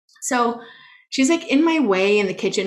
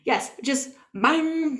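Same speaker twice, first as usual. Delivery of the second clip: ordinary talk, nothing marked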